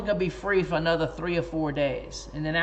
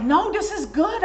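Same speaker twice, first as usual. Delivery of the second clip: much higher, eager insistence; insistent friend; 13 semitones higher, 3 dB louder than usual. Retold or imitated. Friend Lisa insisting to him the chapter is good, eager and high. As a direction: push back brightly that it's good.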